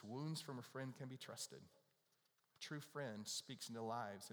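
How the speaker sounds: solemn scripture recital; scripture reading. Acted. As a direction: read the verse slowly and softly. Reads a scripture verse.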